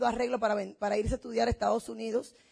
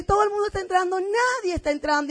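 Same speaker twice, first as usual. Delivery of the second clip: incredulous retort; 6 semitones higher than usual. Retold or imitated. Acted retort in a couple's argument.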